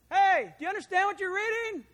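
loud and high, calling across to a moving chariot; a runner calling out to the chariot, loud. Acted. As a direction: shout the question to him as you run alongside.

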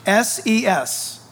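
letters spelled out slowly. Shown as a demonstration. Preacher spelling the word for the congregation, slow. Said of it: "That's S I N E S E S".

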